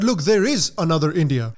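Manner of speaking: ordinary delivery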